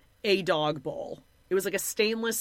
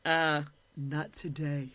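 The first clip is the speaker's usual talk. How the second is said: imitated reluctant refusal; 5 semitones lower, 4 dB quieter, slower than usual. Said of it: "she's like".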